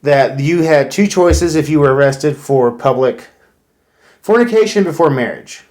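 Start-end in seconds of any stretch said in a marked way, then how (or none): none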